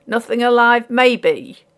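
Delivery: ordinary delivery, nothing marked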